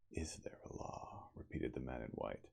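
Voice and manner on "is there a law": whisper. Acted.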